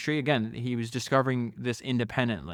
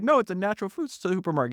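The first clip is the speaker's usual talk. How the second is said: higher-pitched retold insistence; insistent correction; 7 semitones higher than usual. Founder correcting a businessman, mock insistent.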